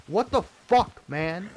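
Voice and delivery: loud, high and slow; exasperated outburst. Real, not acted. Ranting streamer exploding at the world, loud and slow. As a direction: burst out in disbelief.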